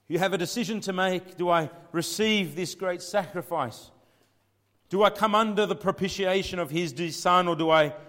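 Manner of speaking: fervent preacher pressing a decision; urgent loud preaching. Acted. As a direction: drive the choice home to the sinner. Preacher repeats it forcefully.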